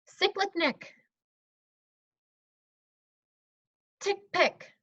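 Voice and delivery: high-pitched pupil reading grouped words; student reading aloud. Pretend. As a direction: read the little group of words in one breath, high. Plays student reading in one breath.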